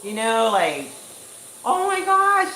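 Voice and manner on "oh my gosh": high, loud burst of excitement; heart-racing happy squeal of words. Retold or imitated. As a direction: gush it, thrilled, pitched way up. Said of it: "I am happy. Like, I am, like".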